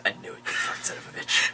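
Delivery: soft, whispered father's reaction; hushed mock swearing. Father learns son's secret, hushed retold reaction. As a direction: imitate it under the breath.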